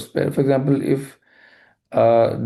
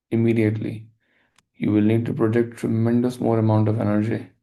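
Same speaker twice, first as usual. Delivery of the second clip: guided meditation; 3 semitones lower, 3 dB quieter than usual. Acted. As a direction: lead the listener gently into forgiveness. Leads a forgiveness meditation.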